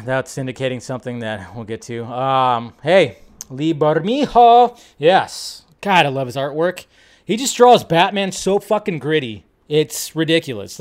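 ordinary talk, nothing marked

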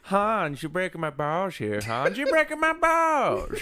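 high, slow mock accent; hammed-up Team America impression.

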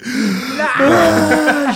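imitated gasp into a high, loud wail; comic high-pitched sob, drawn out; mock crying wail. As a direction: mimic a wife bursting into tears. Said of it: "she just starts crying".

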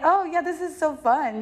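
high-pitched mock delight; sarcastic enthusiasm. Telling what this situation is not, sarcastic enthusiasm. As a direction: fake being thrilled. Mocking that it is fun.

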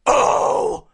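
low distorted metal scream. Shown as a demonstration. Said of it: "I can go low".

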